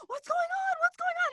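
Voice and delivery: hammed-up panic of rushing staff; alarmed adults. Retelling staff bursting into the bathroom, mock alarmed. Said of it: "They're like".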